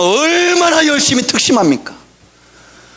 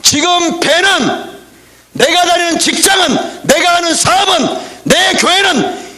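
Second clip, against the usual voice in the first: higher, slow, shouted sermon climax; preaching at full force; 4 semitones higher, slower than usual. Acted. Pastor rebukes a sleeping congregation, shouting. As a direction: hammer it home from the pulpit.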